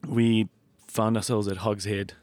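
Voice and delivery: normal talking voice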